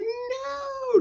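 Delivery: very high, drawn-out mock wail; mock anguished cry. Heroine answers the gloating villain, mock anguish. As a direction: imitate a heroine's thin cry of despair.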